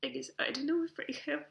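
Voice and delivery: tearful, voice breaking; choked up and halting; crying. Real, not acted. Crying while addressing niece.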